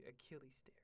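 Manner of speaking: hushed whisper at the end; whispered aside. Real, not acted. Ranting about a contract, then a whispered aside. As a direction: drop to a whisper.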